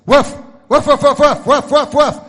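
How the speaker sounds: loud, high yapping woofs; dog barking. Acted. The dog dictating his telegram, loud high woofs. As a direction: bark it out like an excited little dog. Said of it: "So the dog goes".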